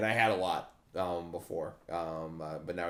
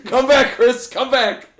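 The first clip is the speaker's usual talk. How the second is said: mock calling out; 10 semitones higher, 10 dB louder, slower than usual. Mock calling after friend.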